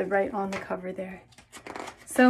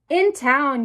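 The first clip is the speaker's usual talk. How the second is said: ordinary delivery, nothing marked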